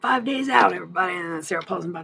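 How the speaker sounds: loud, high promo hype; hyped announcement. Acted. Streamer hyping viewers for the upcoming drop, loud and high. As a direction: sell it like a party announcer.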